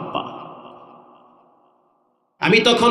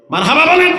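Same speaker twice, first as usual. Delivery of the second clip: raised, louder call to the crowd; rousing call; 7 semitones higher, 4 dB louder, faster than usual. Real, not acted. Preacher calling the crowd to cheer, raised and loud. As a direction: rouse the congregation to answer.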